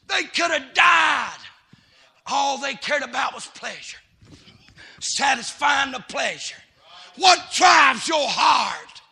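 fiery revival preacher calling out; preaching at full force. Acted. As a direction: hammer it home to the congregation.

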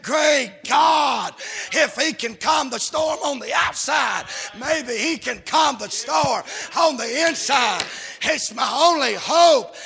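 loud, high pulpit shout as the madman; full-force preacher voicing a quote; impassioned preached cry. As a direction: cry out in desperate hope, hammering each phrase.

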